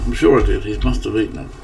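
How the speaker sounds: usual speaking voice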